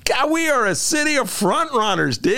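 high, whiny hurt alligator character; Alligator Bob voice. Acted. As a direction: whine it like a wounded gator. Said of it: "My Alligator Bob impression".

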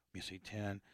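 low, very soft counting under the breath; counting to himself. Real, not acted. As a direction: count quietly down a list for yourself. Counting names on his list to himself.

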